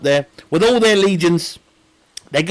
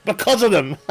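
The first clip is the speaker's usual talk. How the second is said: ordinary delivery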